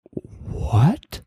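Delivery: high, rising stunned question; totally thrown, ask it upward; mock bafflement. Friend just cursed him out, baffled.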